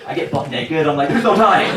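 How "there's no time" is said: higher and louder, panicked; frantic. Retold or imitated. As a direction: rush it out in a panic. Frantic rush at security.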